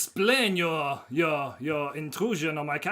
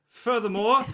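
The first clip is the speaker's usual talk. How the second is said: the word Furthermore said with a put-on French accent, a bit higher; 5 semitones higher, 3 dB louder than usual. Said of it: "He's fallen into that, that French accent".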